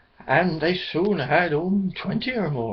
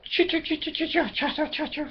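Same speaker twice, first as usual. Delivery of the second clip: starling chatter; 11 semitones higher, 4 dB quieter, faster than usual. Acted.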